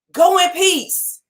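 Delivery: high, slow "Go in peace"; slow high proclamation. Shown as a demonstration. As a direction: lift it up like a benediction. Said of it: "What does that mean?".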